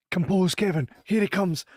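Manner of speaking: steady yourself before he arrives; inner self-talk. Retold or imitated. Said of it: "And I'm like".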